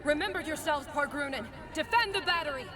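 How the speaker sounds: high, loud distant war cry; rally the troops with a yell; battle shout. Acted. Game soldier shouting in battle.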